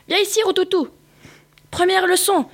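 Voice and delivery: high, bossy teacher's call; professor shouting. A narrator's reading. Rabbit teacher bellowing at his dog pupil, high and bossy. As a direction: shout orders like a strict teacher. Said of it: "hurle le professeur".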